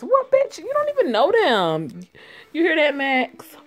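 mock her in a high put-on voice; high-pitched jeering villain; high mocking thug voice. Voicing a mocking thug character.

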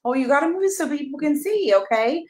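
ordinary delivery

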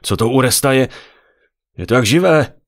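loud, high horrified exclamation; startled, appalled outcry. A narrator's reading. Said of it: "zděsil se".

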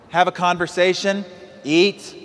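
ordinary delivery

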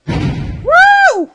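high, loud woo; excited whoop. Real, not acted. Player reacting to the game, high loud whoop. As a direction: let out a piercing whoop.

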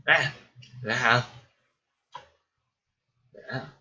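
hiccuping mid-sentence; sudden sharp hiccup sounds. Real, not acted. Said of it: "Excuse me for the hiccups".

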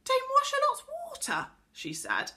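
very high, loud incredulous question; surprised. A narrator's reading. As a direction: echo it in utter astonishment. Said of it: "looked up in surprise".

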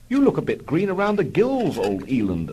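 hammed-up officer, high start; smooth Biggles. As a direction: observe airily, like a cool flying ace. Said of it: "observed Biggles smoothly".

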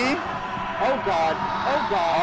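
loud panicked groans; scared horror game streamer. Real, not acted. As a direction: gasp it out in fright. Chased by monster.

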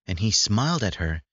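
ordinary delivery, nothing marked

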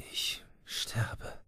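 breathless whisper of a dying man; voiceless dying whisper. A narrator's reading. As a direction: breathe the words, no voice left. Dying man to his friend.

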